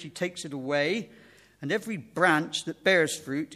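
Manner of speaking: measured, emphatic Bible reading; scripture reading. Acted. Preacher reading John's Gospel to the congregation, emphatic scripture reading. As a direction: read it out with weight, phrase by phrase.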